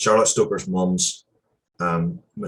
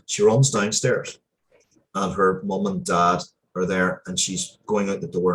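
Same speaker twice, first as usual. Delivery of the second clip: ordinary talk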